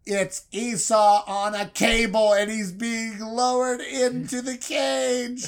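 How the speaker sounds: high, loud, slow build; rising hype. A narrator's reading. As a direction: announce the twist like play-by-play. Big reveal narration.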